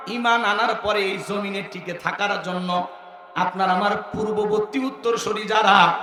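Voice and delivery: normal talking voice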